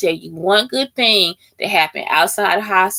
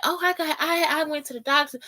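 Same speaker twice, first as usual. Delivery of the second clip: higher, softer chatty imitation; oversharing Texan stranger; 5 semitones higher, 6 dB quieter than usual. Texan stranger answers "how you doing", mock chattiness. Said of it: "they be perfect fucking strangers".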